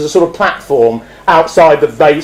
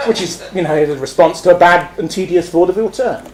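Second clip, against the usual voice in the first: ordinary delivery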